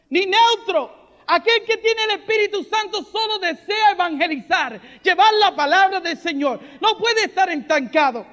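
ordinary delivery

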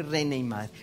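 usual speaking voice

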